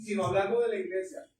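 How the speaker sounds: ordinary talk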